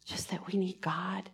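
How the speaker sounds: breathy whispered aside. Real, not acted. Pastor confiding to the congregation in a whisper.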